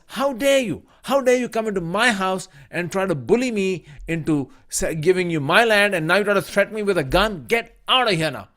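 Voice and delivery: loud, high furious retort; angry outburst. Retold or imitated. Mother scolding the armed developer, high and loud anger. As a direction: tell him off with fierce indignation.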